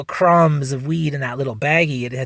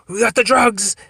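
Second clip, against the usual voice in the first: higher-pitched mock announcement; imitating eager young cop; 7 semitones higher, 3 dB louder than usual. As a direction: report it like a keen rookie. Young cop announcing his find.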